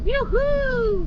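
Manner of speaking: high, joyful whoop of delight; excited whoop. Real, not acted. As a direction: cheer with real delight. Reaching the snow in a 4x4.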